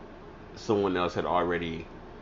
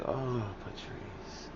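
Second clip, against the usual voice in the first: soft and slow, drawn out; a friend's soft, pitying "Oh, Patrice"; 4 semitones higher, 10 dB quieter, much slower than usual. Retold or imitated. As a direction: say her name gently, almost sorry for her. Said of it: "She was like".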